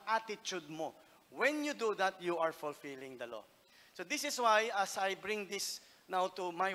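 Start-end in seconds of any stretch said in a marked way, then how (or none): none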